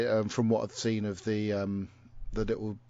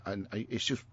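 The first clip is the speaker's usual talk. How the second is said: ordinary talk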